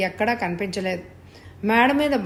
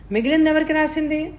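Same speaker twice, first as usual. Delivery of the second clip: shocked demand; 7 semitones higher than usual. A narrator's reading. Shocked at the will news.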